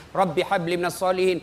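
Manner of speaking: normal talking voice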